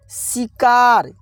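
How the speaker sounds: शिकार stretched out very slowly, syllable by syllable; slowly drawn out. Shown as a demonstration. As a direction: draw out शिकार slowly, as if sounding it out for pupils. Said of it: "क्या होगा?". (शिकार को धीरे-धीरे खींचकर बोलें)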